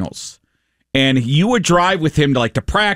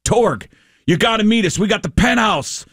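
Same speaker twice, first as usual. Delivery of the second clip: high-pitched, eager retold phone invitation; excited buddy; 6 semitones higher than usual. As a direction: act out a buddy urging a friend out.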